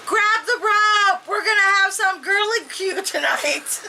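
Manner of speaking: high, gleeful mock yell; story yelling. A narrator's reading. Cannibal girls to their family, yelling. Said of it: "the girls start yelling".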